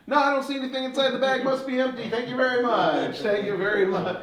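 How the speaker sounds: high, fast fake innocent denial; mock innocence. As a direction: play dumb for the kids.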